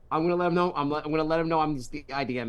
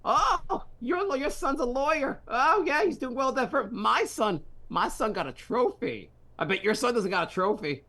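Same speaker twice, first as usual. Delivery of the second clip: put-on high, fussy mom voice with an odd accent, much higher; mom bragging in a voice; 8 semitones higher than usual. Mother boasting to another mom in the store, in a high put-on accent. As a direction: brag about your son like a nosy mother at the grocery store. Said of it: "She's running into".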